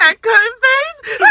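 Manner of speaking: real laughing squeal at her own joke; laughing shriek. Real, not acted. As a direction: burst out laughing mid-line. Joking about her sister fighting.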